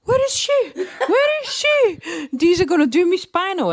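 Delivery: high-pitched, wailing mock panic; retold panic. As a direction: cry out for your partner, voice shooting up. Retold panic before the spinal.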